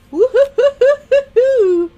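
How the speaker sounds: high, loud chain of hoots; gleeful whoop. Real, not acted. Thrilled over fudge and cookies, gleeful whooping. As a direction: whoop it out in bouncing bursts.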